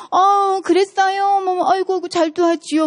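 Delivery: put-on high, cooing baby talk; doting cute mother. Lecturer imitating a mother doting on her child, high and cooing. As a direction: gush over the child as lovingly as possible. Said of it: "막 이러면서 최대 사랑스럽게, 막 귀엽게".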